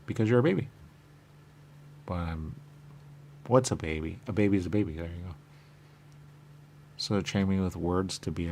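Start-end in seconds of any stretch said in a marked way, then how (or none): none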